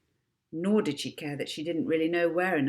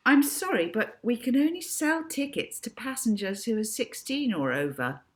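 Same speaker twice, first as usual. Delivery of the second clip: high, official refusal; prim airline clerk; polite clerk; 7 semitones higher than usual. A narrator's reading. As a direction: clerk refusing a small girl.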